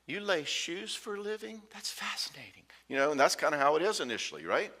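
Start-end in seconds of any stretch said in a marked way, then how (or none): 0.0-2.6 whispered, awed and dreamy; whispered mock adoration from a smitten date; breathy admiring girlfriend. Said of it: "She's like".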